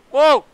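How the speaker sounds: very loud, high "whoa"; real jump-scare shout; startled yelp. Real, not acted. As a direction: yelp in sudden shock. Startled in the game.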